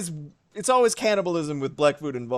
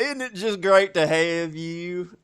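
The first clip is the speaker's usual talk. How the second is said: slow and drawn out, stretching "great" and "have you"; exaggerated welcome; slower than usual. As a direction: drag every word out in a hammed-up welcome.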